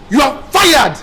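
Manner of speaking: furious firing. Acted. Producer fires makeup artist on the spot, shouting. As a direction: order them out at full volume.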